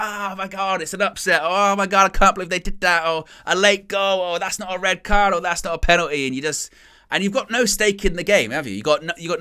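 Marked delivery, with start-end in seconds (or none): 0.0-6.3 higher, louder mock outrage at a match; going crazy. Football fan reacting to a dramatic match, going crazy. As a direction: act out a fan losing it over the drama. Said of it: "you go crazy".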